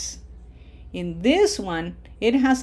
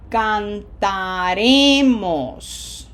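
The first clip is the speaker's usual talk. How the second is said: Cantaremos, very slow, with a loud RE; stress on second-last syllable; 5 dB louder, much slower than usual. Shown as a demonstration. Teacher modelling for students, slow Cantaremos stressed on RE. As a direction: draw out Cantaremos, swelling on RE. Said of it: "on the red syllable, syllable number two".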